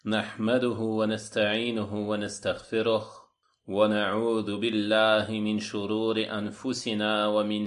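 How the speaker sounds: measured liturgical recitation on a level tone; formal Arabic invocation. Acted. Lecturer opening the lesson with praise of God, formal recitation. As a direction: intone the opening praise with long, sustained vowels.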